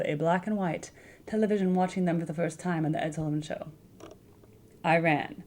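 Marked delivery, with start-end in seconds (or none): none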